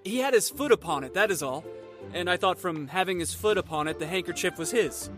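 put-on high, tentative young Gascon; meek, high-voiced explanation; timid. A narrator's reading. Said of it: "hazarded D'Artagnan timidly".

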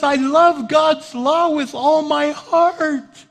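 high, slow, drawn-out scripture recital; impassioned preaching. Acted. Preacher voicing Paul at war with his mind, impassioned. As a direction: stretch each phrase out high for dramatic weight. Preacher dramatises Paul's confession.

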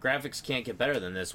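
ordinary talk, nothing marked

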